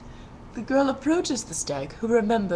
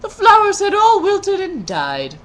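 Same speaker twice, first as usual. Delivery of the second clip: mock sobbing narration; 11 semitones higher, 10 dB louder than usual. A narrator's reading. Narrator grieves the dead flowers.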